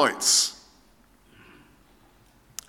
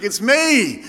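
drawn-out, exasperated punchline; acted exasperation; exasperated reveal; 4 dB louder than usual. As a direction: spell it out to a clueless visitor.

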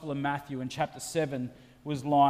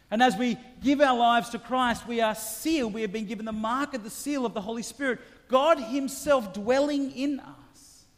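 raised, fervent sermon voice, slowing on God himself; full-force preaching, higher and louder; 8 semitones higher, 4 dB louder than usual. Acted. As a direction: preach it out to the congregation. Preaching, rising intensity.

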